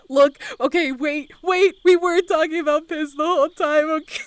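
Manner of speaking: high, loud, laughing-edged defense; flustered protest. Real, not acted. Streamer explaining the piss talk to newcomer, flustered protest. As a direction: insist loudly, high-pitched and flustered.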